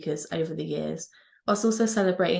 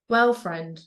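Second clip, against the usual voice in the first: ordinary delivery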